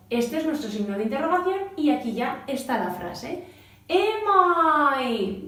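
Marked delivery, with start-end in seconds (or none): 3.9-5.4 Am I with a rising question tune; question intonation. Shown as a demonstration. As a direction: lift Am I into a clear question.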